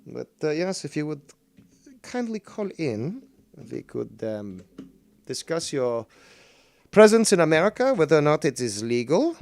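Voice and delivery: loud, high put-on foreign accent; conqueror of Sweden accent. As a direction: boom it out like a swaggering conqueror. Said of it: "I'm doing the one of the conqueror of Sweden".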